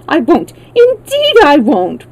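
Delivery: high, emphatic vow; loud, high-pitched pleading assurance; earnest promise. A narrator's reading. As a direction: promise eagerly, stretching the last words. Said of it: "promised the Wheeler".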